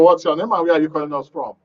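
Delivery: ordinary delivery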